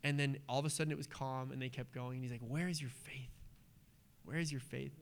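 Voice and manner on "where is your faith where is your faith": gentle quiet rebuke. Retold or imitated. Jesus gently rebuking disciples.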